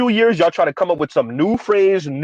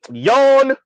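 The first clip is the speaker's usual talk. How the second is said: bored yawn; 6 semitones higher, 4 dB louder than usual. Shown as a demonstration.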